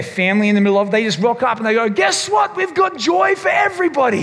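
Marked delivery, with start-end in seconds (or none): 1.9-4.2 high, bright mock announcement, shouted; hammed-up cheerful angel shout; mock excited angels. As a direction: angels blurting out good news, mock gleeful shout. Angels announcing joy breezily.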